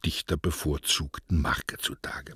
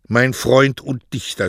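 higher, louder firm address; resolute; 7 semitones higher, 7 dB louder than usual. A narrator's reading. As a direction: declare it with resolve. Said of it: "sagte er entschlossen". (entschlossen, laut)

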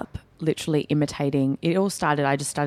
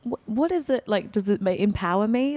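higher-pitched, wondering questions; quizzical self-quote; 7 semitones higher than usual. Retold or imitated. Presenter quoting her own documentary to the host, quizzical. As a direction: voice the questions high, acting out curiosity.

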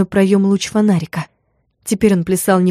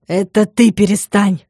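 snapping back; slower than usual. A narrator's reading. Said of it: "огрызнулся Витя уже тише".

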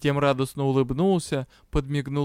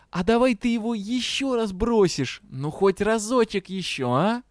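higher-pitched, wheedling plea; pleading; 6 semitones higher than usual. A narrator's reading. Dragon begging for one more throw, pleading. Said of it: "вдруг взмолился".

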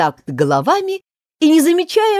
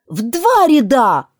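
loud, slow, raised cry; shout; 7 semitones higher, 5 dB louder, slower than usual. A narrator's reading. Mock Turtle corrects Gryphon with a slow shout. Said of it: "закричал Подкотик".

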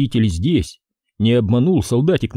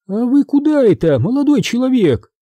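high, loud call from an upper window; a stranger woman challenging from above; voiced woman's call; 13 semitones higher, 4 dB louder than usual. Unseen woman calls from window.

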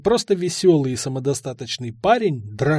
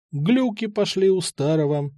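ordinary delivery, nothing marked

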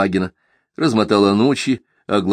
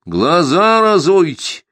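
louder, higher, slow demand; raised voice; 9 semitones higher, 5 dB louder, slower than usual. Acted. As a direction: raise your voice at them. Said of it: "повысил голос Симагин".

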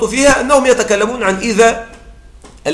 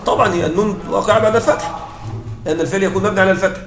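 ordinary talk

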